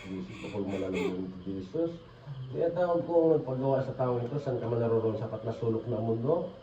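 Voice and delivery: low, hushed ritual prayer; murmured incantation. Real, not acted. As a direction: recite a summoning spell under your breath. Healer recites incantation over patient.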